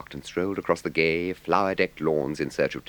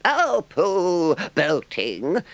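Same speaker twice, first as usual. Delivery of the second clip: much higher, loud scoff; pooh-pooh it with disgust; 13 semitones higher, 5 dB louder, much slower than usual. A narrator's reading. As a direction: Toad brushing off rowing, scornful. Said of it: "in great disgust".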